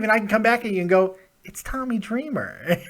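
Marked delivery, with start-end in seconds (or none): 1.4-2.8 soft, slow, unimpressed "It's Tommy Dreamer"; with disdain. Shown as a demonstration. Host answering the caller about Dreamer, with disdain. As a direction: say "Tommy Dreamer" slowly and softly, like it's nothing special. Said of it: "You could say it with disdain".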